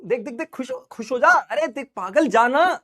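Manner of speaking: excited, playful mock nagging; goading iris character. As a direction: play the iris egging the pupil on. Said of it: "iris क्या कहता है?".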